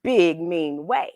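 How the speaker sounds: lively picture-book narration; storybook reading. A narrator's reading. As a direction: read it like bedtime story time. Reads picture book aloud.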